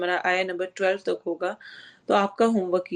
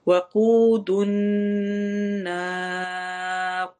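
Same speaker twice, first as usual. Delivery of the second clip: slow chant with a longer stretch on النار; slow Quran recitation; much slower than usual. Shown as a demonstration. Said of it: "زیادہ لمبا کرتے ہیں". (مد عارض کے ساتھ لمبا کر کے پڑھیں)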